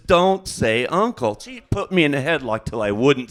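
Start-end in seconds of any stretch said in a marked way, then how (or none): 0.1-1.4 higher, louder, slow hammed-up demand; mock angry command. Aunt with nephew in a headlock, mock angry order. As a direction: bark it out while pretending to hold a headlock. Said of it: "and says".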